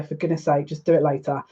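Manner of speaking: usual speaking voice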